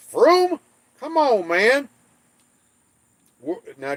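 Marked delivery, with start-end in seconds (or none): 0.1-1.9 Froome shot up high and loud as "FROOM?"; disbelieving squeal of Froome; astonished echo. Real, not acted. Stunned that Frome is said Froome, he squeals it back. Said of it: "Oh my gosh".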